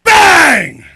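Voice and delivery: a sudden, very loud, high "Bang!"; wrestling impact shout. Pretend. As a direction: slam it like a finishing move. Sound effect for the diamond cutter.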